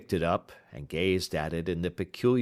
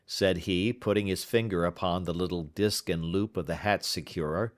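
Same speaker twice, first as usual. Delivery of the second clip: usual speaking voice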